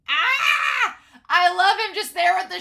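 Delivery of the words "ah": high, loud squeal of delight; excited fan scream. Real, not acted. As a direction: shriek like an overwhelmed fan. Fan screaming over a favorite scene.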